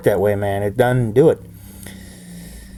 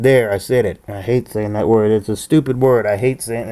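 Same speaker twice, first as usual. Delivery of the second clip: ordinary talk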